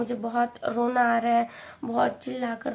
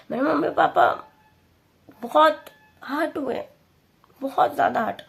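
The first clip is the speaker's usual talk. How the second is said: pained about her parents; upset; 2 semitones higher, 3 dB louder than usual. Real, not acted. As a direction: close to tears, raised pitch. Grief over hurting her parents.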